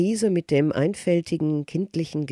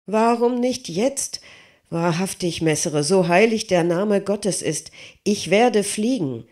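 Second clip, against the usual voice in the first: higher, louder, insistent begging of a simple giant; pleading smith; 6 semitones higher, 3 dB louder than usual. Acted. Smith begging Leonardo to let him test the wings, higher and louder. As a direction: plead hard to be allowed to fly.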